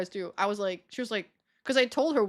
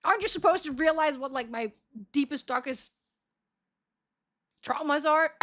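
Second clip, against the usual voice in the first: indignant retort; 5 semitones higher than usual. Retold or imitated. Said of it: "I'm like".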